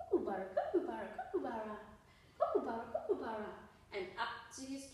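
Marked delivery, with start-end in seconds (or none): 0.1-3.5 laughing through the chant; chuckling kookaburra chant; chuckling song. Acted. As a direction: giggle the bird's name as you sing it. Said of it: "and chuckling will sing".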